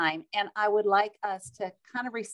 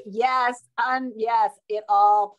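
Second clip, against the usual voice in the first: usual speaking voice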